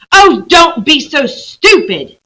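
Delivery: high, loud, slow scorn; exasperated girl. Acted. Lucy scolding Trumpkin, high, slow and exasperated. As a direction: drag it out, fed up. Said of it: "said Lucy".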